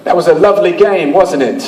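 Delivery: put-on practised genteel-English-style accent. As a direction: show off a borrowed accent for laughs. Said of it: "I'd practice accents".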